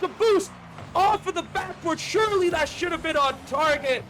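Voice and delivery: fast, high, energetic commentary; live play-by-play. Real, not acted. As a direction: call the match live.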